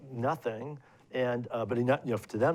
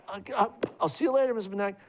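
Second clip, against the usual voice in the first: high, fast flustered farewell; nervous goodbye; 10 semitones higher, faster than usual. Retold or imitated. Tenant hurrying away from his stunned landlady, high and fast. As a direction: rush the goodbye, pitched up and flustered. Awkward exit from shocked landlady.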